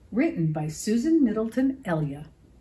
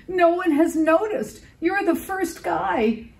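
teary troll; 10 semitones higher than usual. A narrator's reading. Troll moved to tears.

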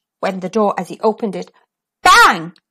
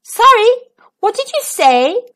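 feigned deafness; 10 semitones higher, 4 dB louder than usual. Acted. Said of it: "pretending not to hear".

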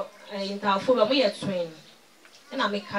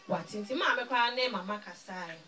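normal talking voice

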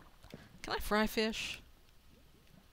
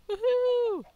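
much higher, loud celebratory whoop; 7 dB louder than usual. Real, not acted. Player celebrating his finished smeltery, high whoop. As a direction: cheer it out.

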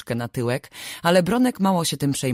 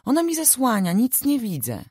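put-on high, whiny little-girl protest; a small girl complaining she can't see; indignant child, voice much higher; 11 semitones higher than usual. A narrator's reading. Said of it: "protestowała oburzona".

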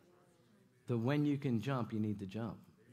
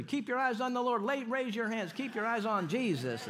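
high loud call to look up; preacher's stage command; 10 semitones higher, 5 dB louder than usual. Retold or imitated. Preacher retells calling to the congregation, loud high command. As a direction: call it out over the crowd, higher and louder.

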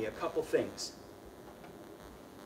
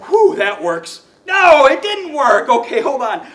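loud hammed-up dismay, higher; mock alarm; 8 semitones higher, 17 dB louder than usual. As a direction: shout out that it failed in playful panic. Trick fails, mock panic.

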